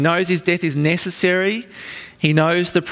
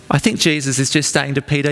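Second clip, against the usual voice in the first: usual speaking voice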